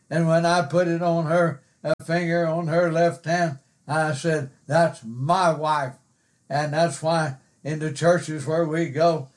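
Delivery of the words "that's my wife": "my" stretched slowly before wife; slow, proud weight on my; stress on MY. Retold or imitated. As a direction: draw out my in "my wife". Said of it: "put some emphasis on that personal pronoun".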